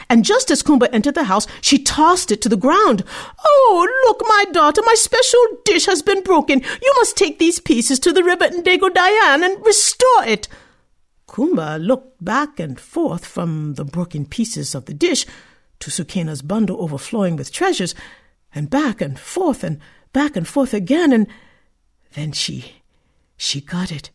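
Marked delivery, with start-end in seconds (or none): 3.4-10.5 high, loud false distress; mock dismay. A mother feigning grief at her daughter, mock dismay. Stepmother fakes dismay over dish. 22.1-24.1 hushed realisation. A narrator's reading. Dramatic realisation in story.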